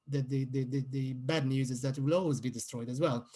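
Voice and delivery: ordinary talk, nothing marked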